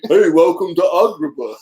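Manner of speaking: mock Aladdin voice; hammed-up cartoon greeting; character voice.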